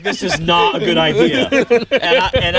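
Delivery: quoting him through laughter; laughing. Retold or imitated. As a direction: giggle your way through his warning. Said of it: "he's like, he's like".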